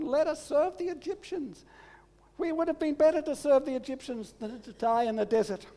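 put-on grumbling Israelites in a high Yiddish accent. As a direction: complain to Moses in a whiny Yiddish accent. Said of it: "They said to Moses".

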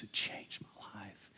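a hushed, slow whisper of gratitude; awed whisper. Real, not acted. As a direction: breathe it out like a secret treasure. Testimony of being saved.

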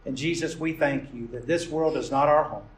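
earnest, rising prayer voice; pastoral prayer. Acted. Pastor leading his congregation in closing prayer, fervent. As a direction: pray it over the congregation with fervor.

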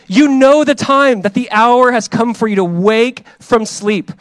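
loud, high preaching that builds to shouted "wake up"; preaching at full force. Acted. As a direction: thunder the call to wake up at the congregation.

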